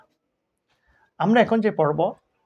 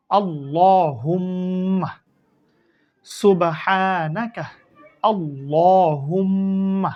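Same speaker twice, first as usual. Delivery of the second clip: slow drawn-out recitation; much slower than usual. Shown as a demonstration. Teacher modelling a phrase for pupils, slow and drawn out. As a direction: draw each syllable out slowly and evenly. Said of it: "teacher blends spelled syllables slowly".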